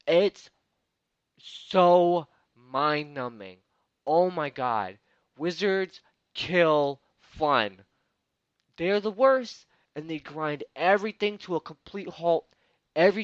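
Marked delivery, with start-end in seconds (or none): 0.1-5.0 slow, loud exasperation; exasperated rant. Real, not acted. Ranting about wizards. 5.4-11.6 slow, loud and fed up; exasperated rant. Real, not acted. Ranting about wizards.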